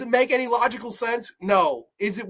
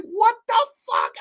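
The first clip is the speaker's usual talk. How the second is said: very high incredulous cry; hammed-up baffled outburst; mock astonishment; 4 dB louder than usual. Viewer reacts to absurd episode, high-pitched disbelief.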